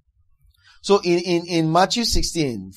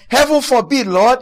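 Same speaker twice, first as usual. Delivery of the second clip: higher, louder protest; reprimand; 6 semitones higher, 6 dB louder than usual. Acted. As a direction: object urgently, as if rebuking. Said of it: "began to reprimand him for saying such things".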